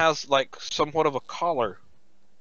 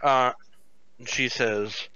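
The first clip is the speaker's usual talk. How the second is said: usual speaking voice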